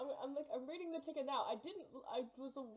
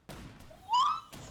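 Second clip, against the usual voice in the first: shrill high scream of disbelief, far louder; loud shriek; 11 dB louder than usual. Real, not acted. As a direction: scream so loud it peaks the mic. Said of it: "Sorry if I'm blowing out the mic".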